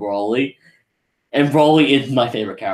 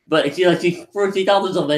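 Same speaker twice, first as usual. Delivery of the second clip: ordinary delivery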